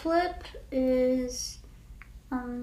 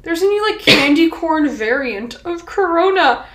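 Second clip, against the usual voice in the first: dumb classmate voice; 5 semitones higher, 8 dB louder than usual. Retold or imitated. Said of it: "someone in my gym class was like".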